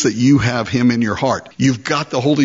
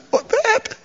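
much higher, alarmed squeak in a different voice; alarmed believer. Acted. Believer hearing the Lord's new plan, alarmed high squeak. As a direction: yelp the question as if dreading the answer. Acted dialogue, believer answering the Lord.

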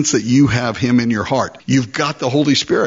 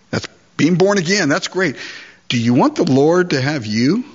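ordinary talk, nothing marked

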